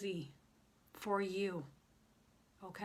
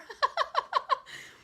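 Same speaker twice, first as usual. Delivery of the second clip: high, rapid burst of laughter; gleeful laugh; 5 dB louder than usual. Real, not acted. Woman teasing a new side of herself, gleeful laugh. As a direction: laugh out loud, high and giddy.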